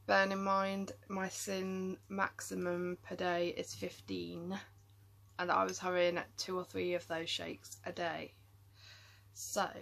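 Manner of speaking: ordinary talk, nothing marked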